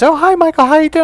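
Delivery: very high, loud mock-female greeting; hammed-up cheery stepmom; imitated chatty stepmom. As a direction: gush a shrieky hello like an overeager stepmom. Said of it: "my stepmom was cleaning".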